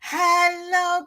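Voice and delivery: ordinary talk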